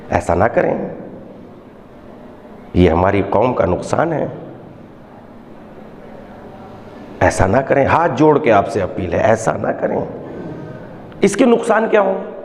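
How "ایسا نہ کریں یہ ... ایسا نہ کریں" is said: much lower, soft pleading voice; earnest plea. Real, not acted. Preacher begging parents about sons' schooling, low earnest plea. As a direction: beg them with folded hands.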